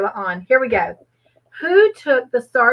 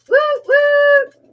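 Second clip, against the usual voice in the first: cheering; 7 dB louder than usual. Real, not acted. Cheering for the quiz winner.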